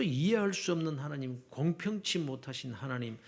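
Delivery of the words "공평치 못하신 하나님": normal talking voice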